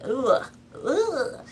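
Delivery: high disgusted ugh; disgusted groan. Real, not acted. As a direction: shudder out an ugh. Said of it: "disgusted reaction".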